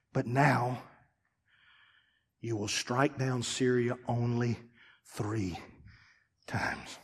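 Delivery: hushed preaching. Acted. Preacher quietly pressing the point, hushed. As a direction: drop to a near whisper.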